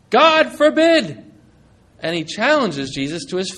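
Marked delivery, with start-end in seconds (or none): 0.1-1.2 outraged protest. Acted. Peter rebukes Jesus to his face.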